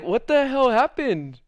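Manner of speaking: slow, high-pitched bewilderment; retold bafflement. Friend hung up on him, baffled retold question. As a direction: draw it out high, thrown off. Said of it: "So I'm thrown off".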